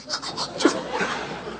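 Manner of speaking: quiet, voiceless stifled laugh; imitated snicker. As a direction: mimic someone snickering under their breath. Friend snickering as he walks in.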